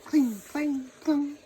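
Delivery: loud ringing cling clang; clashing swords. Acted. Narrator voices swords clashing mid battle, loud clanging.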